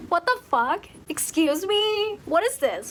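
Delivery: mock outrage, pitched high; outraged disbelief. Reader reacting to an awful book, outraged disbelief. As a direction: act indignant and appalled, drawing it out.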